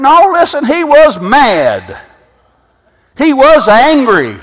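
high, loud preacher's cry; full-force preaching. Acted. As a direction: drive it home to the congregation. Preaching at full force about angry God.

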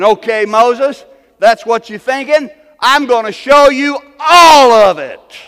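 preacher shouting God's promise to Moses; preached shout. Acted. Preacher voices God's reply.

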